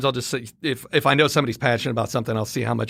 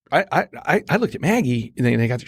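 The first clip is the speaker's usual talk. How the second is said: usual speaking voice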